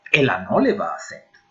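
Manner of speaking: normal talking voice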